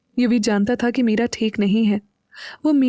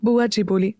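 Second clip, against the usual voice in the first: ordinary delivery, nothing marked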